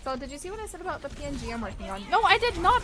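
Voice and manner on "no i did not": much higher, louder mock protest; playful outburst. Real, not acted. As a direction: burst out in teasing denial.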